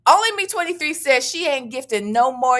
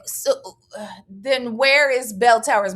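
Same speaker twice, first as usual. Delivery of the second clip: ordinary talk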